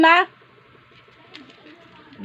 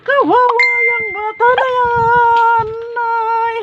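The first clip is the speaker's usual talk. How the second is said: put-on pitying baby talk; 7 semitones higher, slower than usual.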